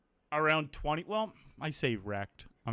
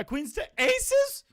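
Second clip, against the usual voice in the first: high, slow squeak of disbelief; disbelieving; 7 dB louder, slower than usual. Real, not acted. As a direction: stretch it out in outrage. Bad beat disbelief.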